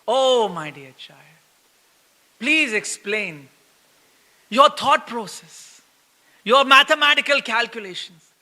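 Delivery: slow, syrupy fatherly asking; overly sweet, ironically patient request; mock-tender parent asking politely; sweet mock inquiry. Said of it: "This is not pariprashnena sevaya".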